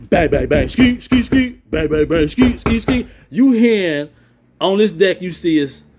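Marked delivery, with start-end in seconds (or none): 0.1-3.1 imitating banging metal doors. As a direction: beat out the cell doors with your voice. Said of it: "And you hear these doors".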